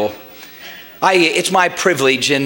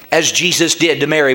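usual speaking voice

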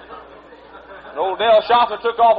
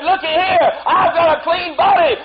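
joyful shout; 7 semitones higher than usual. Retold or imitated. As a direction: call it out, showing off. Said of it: "he said".

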